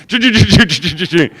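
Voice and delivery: imitating an RV engine sputtering to a stop. Telling the crowd how the RV died, mock engine sputter. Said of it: "the whole thing just went".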